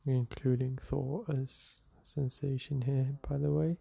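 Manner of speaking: guided meditation. Acted. Teacher guiding listeners through a seated meditation, calm and soft. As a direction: ease the listener into the breath.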